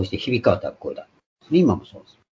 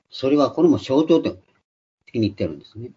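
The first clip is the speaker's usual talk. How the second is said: normal talking voice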